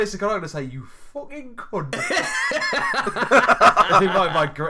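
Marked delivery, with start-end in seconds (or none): 0.6-1.9 slow, put-on Geordie accent. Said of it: "in my greatest Geordie accent". 2.0-4.0 loud laughter. Real, not acted. Laughing at his own joke.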